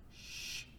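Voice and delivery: soft "shh"; hushing shush. Acted. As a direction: hush someone down. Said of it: "didn't want him to say nothing".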